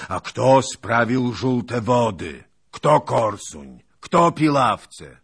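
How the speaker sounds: loud, high, slow furious questions; shouted, drawn-out indignation; angry challenge. A narrator's reading. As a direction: hurl each question at your doubters. Hetman leaps at them like tiger.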